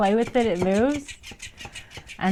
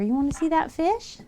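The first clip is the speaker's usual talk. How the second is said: excited pet talk; 11 semitones higher, 6 dB louder than usual. Real, not acted. Owner squealing as her cat hisses at the toy fish. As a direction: squeal at the animals with delight.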